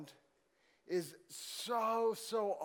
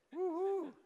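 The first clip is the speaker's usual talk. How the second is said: mock cheer; 12 semitones higher than usual. Mock cheer about school.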